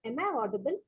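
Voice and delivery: faint, soft-spoken question; barely audible, low volume; too quiet. Real, not acted. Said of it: "Your voice is very low".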